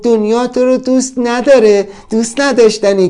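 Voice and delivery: high-pitched mocking mind; taunting inner voice. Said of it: "ذهنم یه روزی گفت".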